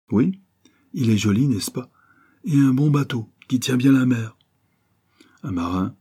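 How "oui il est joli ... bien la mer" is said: lightly voiced local man, higher and animated; indigène serviable. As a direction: point things out eagerly, pitched up. (voix légèrement jouée d'indigène serviable)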